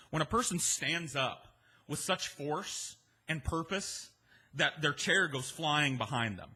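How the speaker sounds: usual speaking voice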